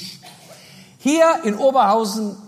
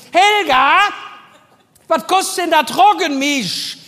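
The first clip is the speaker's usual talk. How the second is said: Helga called loud and high; yelling to the back; 6 semitones higher, 5 dB louder than usual. Retold or imitated. Cashier bellowing back to colleague Helga. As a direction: bellow it over your shoulder into the back room. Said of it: "Beugt sie nach hinten und brüllt".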